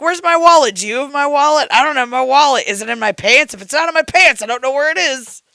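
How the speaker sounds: loud, high, flustered mimicry; mock frantic partner. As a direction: imitate someone frantically hunting, loudly. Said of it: "A lot of times you're like".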